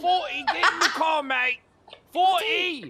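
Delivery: high, loud cockney yelling with a laugh; mock British screaming. Officer acting out the suspect's street screaming, British. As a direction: scream it like a rowdy Londoner.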